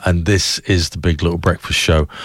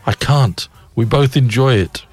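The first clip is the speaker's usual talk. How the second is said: ordinary talk, nothing marked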